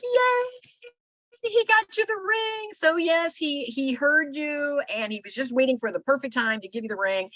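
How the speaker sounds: squealing with joy for a friend; excited cheer. Retold or imitated. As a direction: cheer for the new ring, high. Coworkers cheer her ring.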